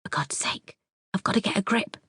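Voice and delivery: whispered to herself; hushed self-rebuke. Acted. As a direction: hush it, scolding yourself under your breath. Narrator steadies herself.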